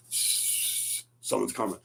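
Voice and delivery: slow hushed warning; tense whisper. Retold or imitated. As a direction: whisper it as if hiding.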